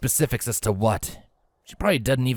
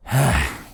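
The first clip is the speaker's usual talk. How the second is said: heavy sigh; 3 semitones higher, 3 dB louder than usual. Acted. Worried brother sighs.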